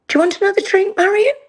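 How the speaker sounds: pointed, sing-song stage delivery of a secret signal; dreaded cue line. Retold or imitated. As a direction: ham up the fellow actor's panic cue. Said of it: "And I hear pause, followed by".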